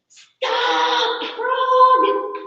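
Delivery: high, loud, stretched-out yell; exasperated Mouse screaming; storybook scream. A narrator's reading. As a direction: scream at Frog to stop. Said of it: "the mouse screams".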